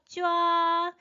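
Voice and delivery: 쫙 held long on one high, level pitch; first tone. Shown as a demonstration. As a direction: draw 쫙 out high and even, like underlining. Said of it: "일성으로 읽어주세요". (쫙을 높고 평평하게 길게, 일성으로)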